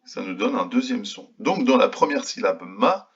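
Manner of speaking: ordinary talk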